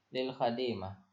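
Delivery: normal talking voice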